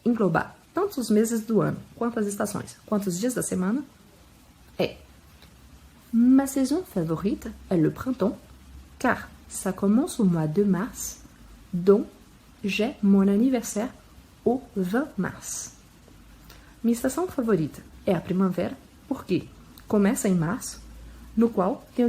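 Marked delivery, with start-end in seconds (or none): 6.1-15.7 slow, careful French reading for learners; teacher modelling a full French answer; slow model sentence. Shown as a demonstration. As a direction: read each phrase slowly and clearly. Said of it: "Um exemplo pra englobar".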